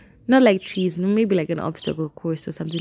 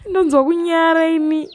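laughing through the words; embarrassed laughter; 9 semitones higher, 4 dB louder than usual. Real, not acted. Laughing at own shame.

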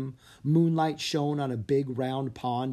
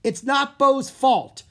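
a high, loud, slow outburst; blurted out; 12 semitones higher, 9 dB louder than usual. Acted. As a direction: blurt it defending your friend. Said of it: "Drake blurted out".

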